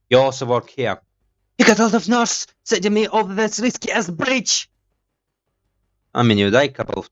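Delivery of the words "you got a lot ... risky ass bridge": high, put-on character voice; voice acting. Gamer voice acting the companion's complaint, high put-on voice. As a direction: play the angry companion, hammed up. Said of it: "so now voice acting".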